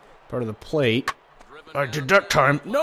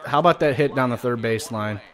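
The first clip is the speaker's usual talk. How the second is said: normal talking voice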